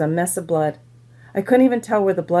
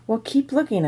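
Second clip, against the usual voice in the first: usual speaking voice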